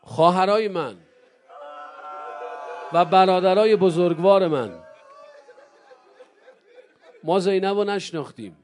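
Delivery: loud, ringing appeal to the congregation; preaching at full force. Acted. Preacher calling out loud to the mourners.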